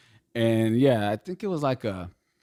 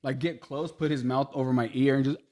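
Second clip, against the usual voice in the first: ordinary talk, nothing marked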